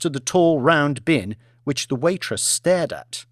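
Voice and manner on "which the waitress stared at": usual speaking voice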